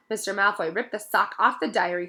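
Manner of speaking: ordinary delivery, nothing marked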